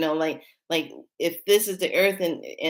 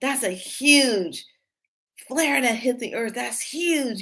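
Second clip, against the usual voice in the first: higher, amazed wow at the huge flare; 6 semitones higher, slower than usual. Retold or imitated. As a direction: retell the amazement, voice lifted. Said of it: "I was like".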